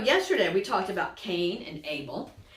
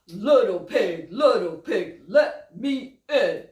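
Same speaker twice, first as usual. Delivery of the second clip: louder, slow wolf voice; 7 dB louder, slower than usual. Acted. Wolf demanding entry at the pig's door, louder. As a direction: growl it out like the wolf. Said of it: "he knocks at the door".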